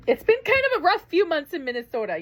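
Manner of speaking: high, tearful and breaking. Real, not acted. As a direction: let the tears come through. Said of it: "I told you I was gonna get emotional".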